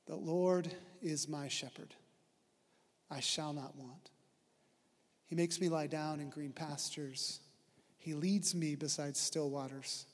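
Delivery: hushed devotional reading; quiet scripture recital. Acted. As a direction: recite the psalm softly like a prayer.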